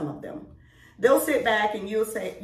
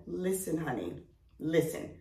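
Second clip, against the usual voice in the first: gentle coaxing; 4 semitones lower, 8 dB quieter than usual. Retold or imitated. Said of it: "and tell a man".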